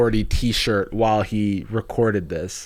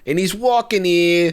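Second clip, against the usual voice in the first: higher, hammed-up New Yorker voice; exaggerated New York wise guy; mock New York accent; 6 semitones higher than usual. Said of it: "it's actually the New Yorker".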